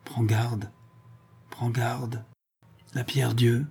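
faint warning. A narrator's reading. Dying father's last warning.

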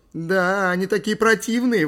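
loud, high squeal of disgust; exclaiming. A narrator's reading. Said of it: "воскликнула Ли". (громкое высокое восклицание)

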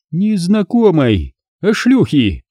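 high, mocking woman's voice; scornful blonde vixen jeering; sarcastic female voice. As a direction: sneer it down from above. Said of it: "язвительный и знакомый голос".

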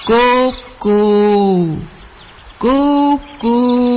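loud, long hooting coo; cuckoo call. Acted. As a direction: hoot out like a cuckoo. The bird calls again.

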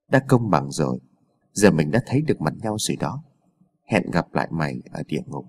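low, soft menacing voice; a quiet, low threat before the strike; sinister double. A narrator's reading. Bloodied double facing Đạt before attacking, low and menacing. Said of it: "gã đàn ông nói tiếp".

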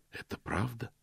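soft, breathy near-whisper; hushed, moved admission. A narrator's reading. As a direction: barely voice "правда". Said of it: "почти прошептал он".

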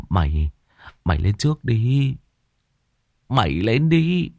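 pushing a mate forward in fright; trembling with fear. Acted. Frightened servant urging another to go first, trembling. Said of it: "Mấy thằng nghe ông thầy nói thì run rẩy".

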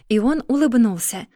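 plain reading voice

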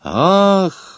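high, sudden startled cry; surprised exclamation. A narrator's reading. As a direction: gasp it out in surprise. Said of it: "воскликнул тюремщик".

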